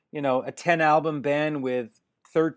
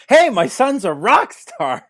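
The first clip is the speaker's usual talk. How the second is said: much higher, louder, slow Hey, my son's a rock star bragging; mock proud dad; 7 semitones higher, 9 dB louder, slower than usual. Dad after his son's fame, mock proud boast. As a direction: crow it out like a bragging father. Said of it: "And now it's".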